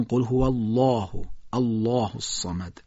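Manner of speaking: slow recitation with dark, thick "الله"; deliberate taghliz demonstration; heavy lam recitation. Shown as a demonstration. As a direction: recite "الله" full-mouthed with a long back "aa".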